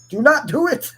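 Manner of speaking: high, loud playful don't; mock warning. Streamer jokingly warning chat not to try it. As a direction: wag a finger in jest.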